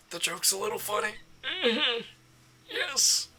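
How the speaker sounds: high amused voice. Pretend. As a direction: squeak it out, delighted. Voicing the amused expression.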